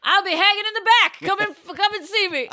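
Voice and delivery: playful beckoning Jesus; mock Jesus host. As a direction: invite them in like a hammy host. Playing Jesus beckoning diners.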